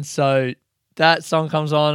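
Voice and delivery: ordinary talk, nothing marked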